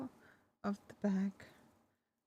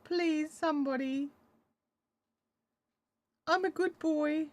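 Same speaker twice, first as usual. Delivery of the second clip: slow, higher begging dog voice; pleading dog; 6 semitones higher, slower than usual. Pretend. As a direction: plead as the dog, drawn out and sweet. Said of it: "He's doing the pleading".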